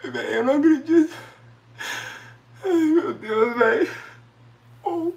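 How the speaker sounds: high, sobbing disbelief; crying with joy. Real, not acted. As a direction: weep through the words. Reaching half a million live. (chorando de emoção, voz aguda)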